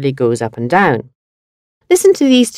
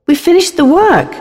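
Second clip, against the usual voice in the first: high and bright with a falling close; happy; 10 semitones higher than usual. Shown as a demonstration. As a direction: announce it gladly. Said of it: "according to the speaker's tone of voice".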